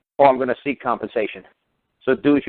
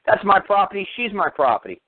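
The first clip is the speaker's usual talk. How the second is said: ordinary talk, nothing marked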